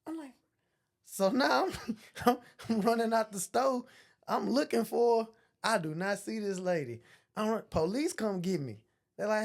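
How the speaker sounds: ordinary talk, nothing marked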